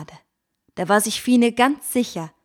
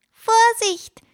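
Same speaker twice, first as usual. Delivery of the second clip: tiny high squeak of a warning; small thin warning voice; 6 dB louder than usual. A narrator's reading. Little bird calls out a warning to Fine, in a tiny high voice. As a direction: warn sharply in a tiny piping voice. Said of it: "mahnte eine kleine, dünne Stimme".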